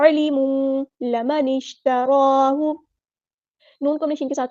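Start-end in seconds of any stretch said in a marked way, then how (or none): 0.1-2.7 अलिमू chanted slowly, the vowel drawn long; correct recitation. Shown as a demonstration. Teacher gives students the right reading, chanting. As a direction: intone अलिमू लमन इश्तराहु like a qari. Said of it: "अलिमू नहीं पढ़ना".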